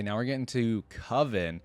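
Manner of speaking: ordinary talk, nothing marked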